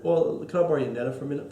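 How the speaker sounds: ordinary talk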